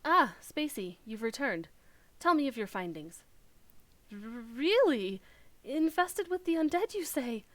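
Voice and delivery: a startled scholar hearing bad news; flustered scholar NPC.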